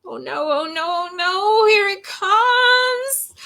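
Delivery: rising panic, ending high and stretched; mock dread. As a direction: build up as if bracing for disaster. Dreads the silly sounds coming.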